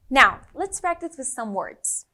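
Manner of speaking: ordinary delivery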